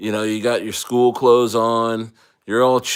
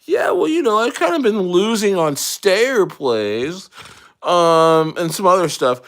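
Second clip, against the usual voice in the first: high-pitched, louder mock admission; imitated excuse, starting very high; acted sheepish confession; 9 semitones higher, 5 dB louder than usual. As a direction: play someone owning up, voice pitched way up.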